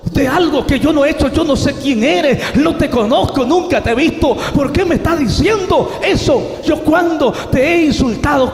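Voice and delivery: high, loud indignant outburst; full-voiced acted fury at being accused; angry protest. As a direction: fire back accusing questions at a shout. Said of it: "yo me enfadé y dije".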